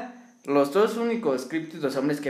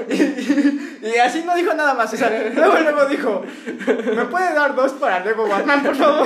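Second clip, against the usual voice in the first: higher-pitched words breaking into real laughter; dying of laughter; 7 semitones higher, 4 dB louder than usual. Real, not acted. Podcaster retelling the ticket request to a friend, laughing helplessly. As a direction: struggle to get the story out through laughs. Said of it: "yo estaba muerto de la risa".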